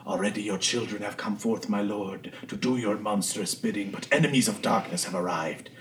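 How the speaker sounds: hushed, solemn invocation; quiet ritual voice of a cultist; dark cult leader. Acted. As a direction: intone a soft prayer to a dark lord. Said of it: "this cult leader in, like, dark robes saying".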